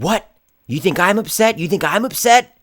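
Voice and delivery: high, loud, incredulous outburst; angry retort. Retold or imitated. Knife-wielding man confronting the one apologizing, angry retort. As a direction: throw it back as an outraged challenge.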